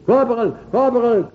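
high, loud hail to a superior; acted call across a yard. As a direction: imitate guards shouting for someone. Retells guards calling their corporal over.

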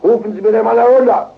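high, loud summons; imperious retold order. Doctor has the patient called down, loud and commanding. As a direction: imitate a doctor ordering someone fetched.

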